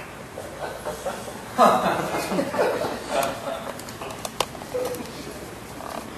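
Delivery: long airy scornful laugh; breathy mocking laugh. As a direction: laugh at him silently. Boss laughs at employee.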